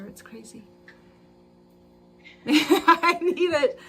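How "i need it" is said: laughing. Real, not acted. Laughing at her messy hair.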